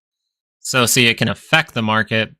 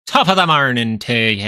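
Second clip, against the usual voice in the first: loud mock Irish greeting, falling in pitch; bad Irish accent; 4 dB louder than usual. Streamer showing off an accent he can't do, bad Irish accent. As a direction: ham up an Irish accent that drifts Scottish. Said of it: "I don't know how to do that accent very well".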